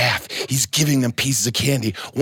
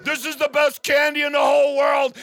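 ecstatic shout; 11 semitones higher than usual. Acted. Principal ecstatic over candy.

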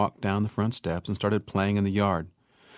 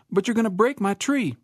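higher, anxious wife voice; a hint of a fretting wife; worried protest; 9 semitones higher than usual. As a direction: object with alarm.

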